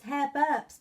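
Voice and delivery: ordinary delivery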